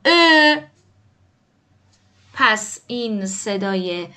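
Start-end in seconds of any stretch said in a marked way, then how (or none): 0.1-0.6 «ا» as a long, loud fronted ö; Turkish Ö vowel. Shown as a demonstration. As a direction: round the lips on a front e for «ا». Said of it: "این حرف رو دقت کنید تلفظشو". («ا» کشیده با صدای ö ترکی)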